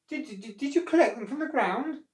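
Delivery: high, stuttering query; puzzled questioning. A narrator's reading. Wife asking crocodile how he got fruit, puzzled. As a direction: stumble into a high-pitched question. Wife quizzes her husband, stammering.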